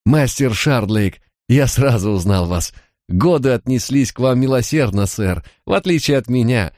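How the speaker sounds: put-on high-pitched, delighted old schoolmate; joyful greeting. A narrator's reading. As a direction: greet an old friend with happy amazement. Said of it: "встревоженное выражение его лица сменилось радостным". (радостно, высоким голосом)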